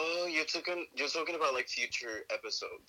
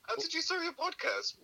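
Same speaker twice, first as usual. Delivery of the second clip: interviewer voice. Pretend. Mock interviewer question.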